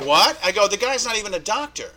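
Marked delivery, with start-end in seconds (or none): 0.1-0.4 sudden louder cry of disbelief; shocked what. Retold or imitated. Hearing his doctor was fake, shocked disbelief. 0.7-1.9 higher and louder, stunned repeat; disbelief. Retold or imitated. Repeating the reporter's words to himself, stunned disbelief.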